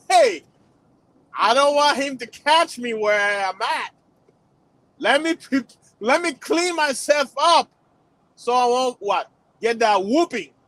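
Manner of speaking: put-on a child scrambling to avoid a whooping; scared child. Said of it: "You'd be like".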